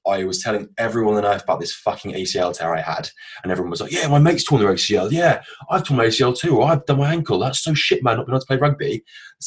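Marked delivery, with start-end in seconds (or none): 3.9-9.0 higher, louder retold replies; eager mock chorus. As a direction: imitate mates chiming in eagerly. Acting out everyone's eager replies.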